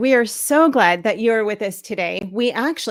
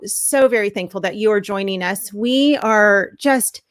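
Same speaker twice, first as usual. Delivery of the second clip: usual speaking voice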